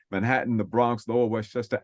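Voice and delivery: ordinary talk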